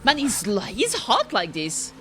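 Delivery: usual speaking voice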